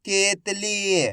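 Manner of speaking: केतली drawn out very slowly, syllables stretched; slow teacher blend of केतली; slowly blended word. Shown as a demonstration. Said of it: "अब अपन इसको repeat करेंगे।".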